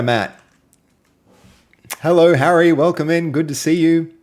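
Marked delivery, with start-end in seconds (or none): 2.0-4.1 excited greeting. Real, not acted. Greeting a new chat viewer.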